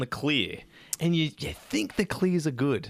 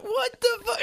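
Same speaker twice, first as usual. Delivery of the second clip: shocked. Retold or imitated. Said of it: "I'm like".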